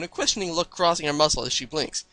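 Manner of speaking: plain reading voice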